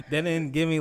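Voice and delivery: laughing through the words; breathless laughing answer. Real, not acted. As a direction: giggle it out, half embarrassed. Laughing at the question.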